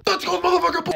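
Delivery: very high, loud yell; high-pitched celebratory holler; hype shout. Acted. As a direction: scream it like a hyped-up fan. Acted hype shout about dancing Venom.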